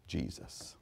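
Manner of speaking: breathy whisper of the name. Acted. Preacher hushes the room on the name, whispered. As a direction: breathe the word like a prayer.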